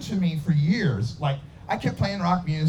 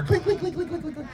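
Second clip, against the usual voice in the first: high clinks fading into the distance; receding bottle clinks; 11 semitones higher, 3 dB quieter than usual. Retold or imitated. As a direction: mimic bottles rolling away, getting softer. Bottles rolling away down theater.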